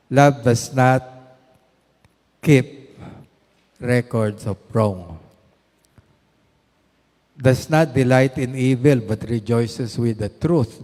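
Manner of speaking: loud, slow preaching of the verse; fervent Bible reading; scripture recitation. Acted. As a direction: proclaim the verse to the congregation.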